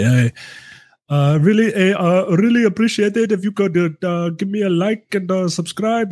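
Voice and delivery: mock YouTuber plea. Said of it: "what do some of these people say?".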